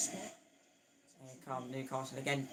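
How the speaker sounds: usual speaking voice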